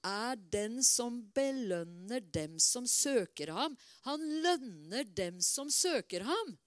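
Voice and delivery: slow, each word weighted; slow emphatic verse. Acted. Preacher pressing the verse home to the congregation, slowly. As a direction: lean hard on the promise.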